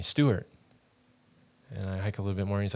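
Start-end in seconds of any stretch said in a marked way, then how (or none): 0.0-0.5 lightly called name, pitched higher; retold call to someone ahead; mild calling out. Jesus calls to the hiker from behind, mild call-out. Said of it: "he calls out to me".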